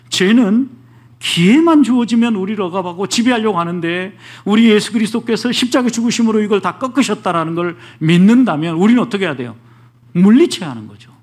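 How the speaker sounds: preacher building to a loud peak; forceful preaching. Acted. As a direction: push the warning out to the pews.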